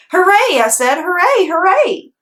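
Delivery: high, loud hooray; cheering little girl. Acted. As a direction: cheer it like a happy kid. Junie B cheering.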